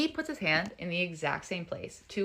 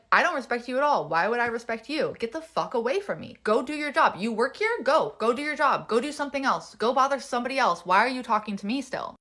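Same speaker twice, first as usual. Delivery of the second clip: higher, heated rapid-fire retorts; retold angry dismissal; 4 semitones higher, 3 dB louder than usual. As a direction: send him away in an acted quarrel. Retold heated argument with the bartender.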